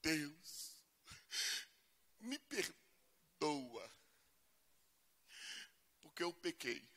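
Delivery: soft, slow, pleading mock prayer; a touch of pitiful sinner. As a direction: whimper it like a guilty child begging. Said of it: "A gente diz assim".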